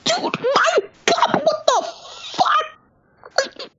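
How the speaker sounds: very high, drawn-out mock scream; acted screaming. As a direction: scream it in exasperated disbelief, acting it out. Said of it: "you all have to be screaming like".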